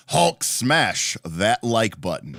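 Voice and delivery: very slow, drawn-out mock roar; hammed-up Hulk. Streamer reading out a viewer's message, hammed-up Hulk. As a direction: stretch each word like a lumbering Hulk.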